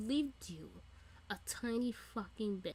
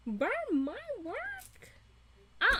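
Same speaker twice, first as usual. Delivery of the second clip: pissed off; 11 semitones higher than usual. Pretend. Fan playing the interviewer whose work burned, outraged squeal. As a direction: stretch it out in mock outrage. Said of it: "so pissed the fuck off".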